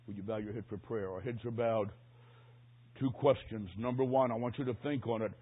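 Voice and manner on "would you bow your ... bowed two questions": ordinary talk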